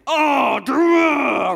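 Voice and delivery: loud, high exasperated yell; mock frustrated shout. Preacher acting out a real frustrated outburst, shouted. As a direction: shout it like stubbing a toe. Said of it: "you're like".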